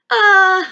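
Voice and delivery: loud, high awkward laugh; nervous laugh. Acted. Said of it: "Kiki laughed nervously".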